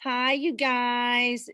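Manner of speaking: slow, drawn-out Midwestern greeting; mocking Indiana accent. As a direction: stretch it out like a teasing Hoosier. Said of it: "we always made fun of them saying".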